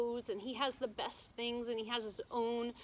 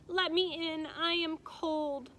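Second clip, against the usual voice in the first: higher, louder begging voice; pleading princess; 7 semitones higher, 4 dB louder, slower than usual. A narrator's reading. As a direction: beg to be let in from the cold. Princess begs at door.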